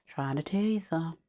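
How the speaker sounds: murmured repeat; quiet echo. Real, not acted.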